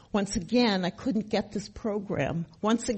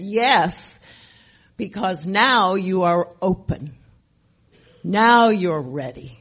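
louder, insistent reply; firm sponsor voice; 7 dB louder, slower than usual. Retold or imitated. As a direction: land it like a certain truth. Sponsor's firm answer quoted.

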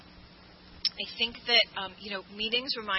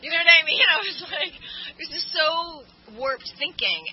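laughing at herself; 8 semitones higher, 13 dB louder than usual. Real, not acted. Laughing at her old self.

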